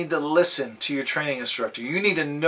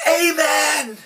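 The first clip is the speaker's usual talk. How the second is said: a sudden, much higher shouted "Amen."; 9 semitones higher than usual. Retold or imitated. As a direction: blurt the amen out at full voice. Visitor shouts amen in church.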